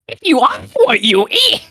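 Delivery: high-pitched, slow silly quote voice; silly put-on voice. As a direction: exaggerate it in a squeaky, drawn-out voice. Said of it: "I'll say it in a normal voice".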